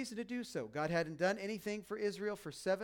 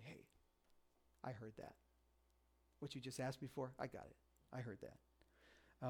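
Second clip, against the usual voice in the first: God whispering; 5 semitones lower, 14 dB quieter than usual. Pretend. God quietly telling the praying pastor he heard, whispered. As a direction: whisper it gently like a secret. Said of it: "Just God whisper and say".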